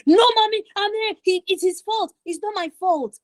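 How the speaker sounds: high-pitched, whiny protest to mommy; bad tone with parents. Shown as a demonstration. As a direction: blame someone else in a high, defensive child voice. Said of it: "Your tone on how you say".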